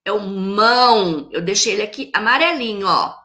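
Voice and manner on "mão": "mão" held long as the stressed syllable; strong syllable. Shown as a demonstration. Teacher answering which syllable is strongest, "mão" drawn out with stress. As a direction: stretch "mão" out firmly. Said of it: "fala com mais força, mais forte".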